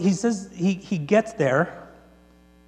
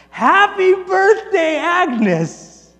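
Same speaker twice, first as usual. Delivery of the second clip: drawn-out, high, loud surprise-party shout; crowd yell; 13 semitones higher, 9 dB louder, much slower than usual. Retold or imitated. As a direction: yell it slowly as a whole cheering room. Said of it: "everyone just yells".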